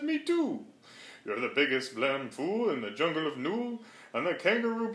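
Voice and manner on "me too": high, small joey chiming in; little joey voice. A narrator's reading. Young kangaroo in the pouch agreeing with mother, high little voice. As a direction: squeak it like a tiny pouch baby. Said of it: "young kangaroo in her pouch said".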